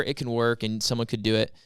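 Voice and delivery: ordinary delivery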